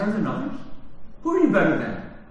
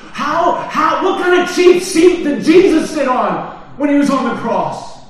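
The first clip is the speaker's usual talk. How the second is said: loud, higher preacher's challenge; fervent preaching; 8 semitones higher, 11 dB louder than usual. Acted. Preacher challenging the church about the cross, loud and fervent. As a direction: press the question hard on the congregation.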